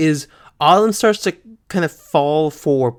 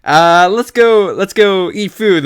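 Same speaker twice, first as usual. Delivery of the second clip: higher, louder imitation of eagerness; rally a friend along, hammed up; mock eager urging; 5 semitones higher, 6 dB louder than usual. Ash hurries Dawn off to eat, mock eager. Voicing Ash's abrupt change of subject.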